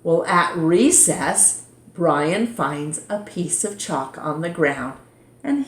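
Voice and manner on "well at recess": plain reading voice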